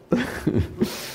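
a high airy laugh; breathless laugh. Real, not acted. Preacher laughs at his own joke, airy and high. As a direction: let a silent laugh escape.

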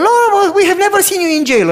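very high, loud pleading imitation; mock protesting disciples. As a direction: cry out like the puzzled disciples. Quoting disciples in Bible.

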